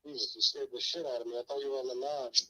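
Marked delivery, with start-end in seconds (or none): none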